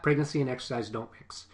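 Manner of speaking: ordinary delivery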